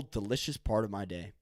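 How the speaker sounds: normal talking voice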